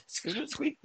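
high mock squeak; squeaky chair squeak. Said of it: "Like a furious squeaky chair sounds".

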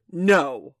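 loud, scornful refusal; emphatic, contemptuous and loud; scornful ringing. A narrator's reading. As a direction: ring it out with scorn. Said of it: "This answer rang out scornfully".

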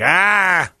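high whiny complaining voice; mock offended fans. Host mocking fans rejecting the apology, high and whiny. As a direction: whine it like a petty critic. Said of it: "Well, they're like".

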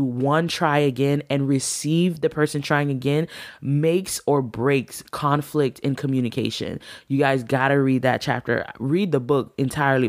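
ordinary talk

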